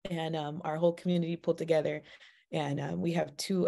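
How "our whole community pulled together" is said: ordinary talk, nothing marked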